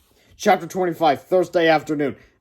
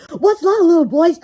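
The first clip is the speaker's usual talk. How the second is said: put-on much higher, louder girl voice; cheerleader voice; 14 semitones higher, 10 dB louder than usual. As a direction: cheerleader calling to the boys. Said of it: "asked one of the cheerleaders".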